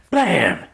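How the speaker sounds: drawn-out vocal gun blast; sudden high "blam" sound effect; imitated gunshot. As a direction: make the shot with your voice.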